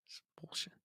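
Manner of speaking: whispered under breath; hushed aside; whispering. Real, not acted. Muttered aside about scammer.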